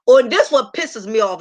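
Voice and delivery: normal talking voice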